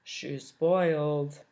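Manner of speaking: low, slow sour drawl; Waffle's voice. Acted. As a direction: drag it out with scorn. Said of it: "Waffle turned to Soup and said".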